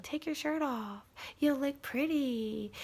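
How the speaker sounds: soft, high coaxing inner voice; voice in the head. Retold or imitated. Mind flatters her into undressing, coaxing. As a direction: coax softly and high, drawing out the end. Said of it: "I remember my mind saying".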